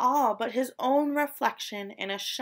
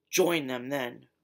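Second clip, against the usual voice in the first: much lower snarling lobster voice; snarled; 9 semitones lower than usual. A narrator's reading. Lobster snarling at Stanley to go join them. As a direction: snarl him away, deep and gruff. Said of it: "snarled the lobster".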